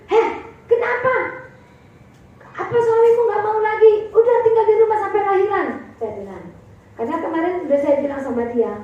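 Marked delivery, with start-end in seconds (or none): 0.1-5.7 high, louder retort, as if taken aback; acting out an alarmed, scolding reply; startled scolding. Pastor retelling her reply to a distressed friend on the phone, startled scolding.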